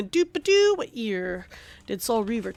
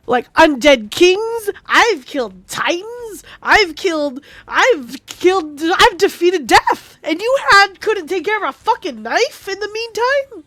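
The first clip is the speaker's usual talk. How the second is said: loud, fed-up ranting at a game character; exasperated rant; 3 semitones higher, 6 dB louder than usual. Retold or imitated. Lore nerd yelling at a useless NPC, exasperated loud rant. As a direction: vent like you've been personally betrayed by the NPC. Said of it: "am ranting to myself".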